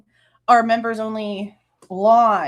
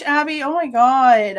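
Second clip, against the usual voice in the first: ordinary delivery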